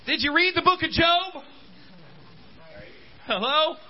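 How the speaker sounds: ordinary delivery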